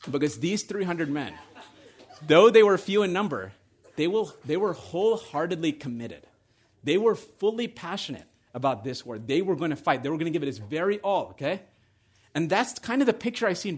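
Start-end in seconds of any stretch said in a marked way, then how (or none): none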